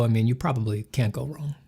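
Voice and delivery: soft unvoiced aside; whispering. Real, not acted. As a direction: whisper it under your breath. Muttered aside.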